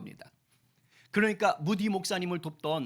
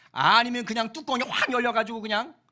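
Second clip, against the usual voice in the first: mock flare-up; 6 semitones higher, 7 dB louder than usual. Describing losing one's temper.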